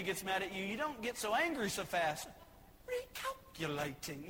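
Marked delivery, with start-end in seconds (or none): 2.9-4.3 slow, soft, low British lady GPS; British lady accent. Retold or imitated. British GPS lady correcting the driver, British accent. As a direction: put on a polite British accent, drawn out. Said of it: "a British lady".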